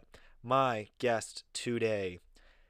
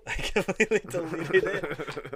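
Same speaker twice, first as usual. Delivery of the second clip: laughing; 8 semitones higher, 8 dB louder than usual. Real, not acted. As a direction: repeat it laughing, higher and louder.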